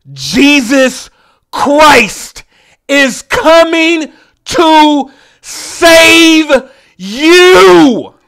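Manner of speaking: rising to a shout. Acted. Comic crushing the crowd's hope, rising to a roar. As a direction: build to a preacher-like roar.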